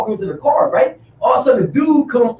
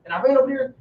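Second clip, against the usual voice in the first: usual speaking voice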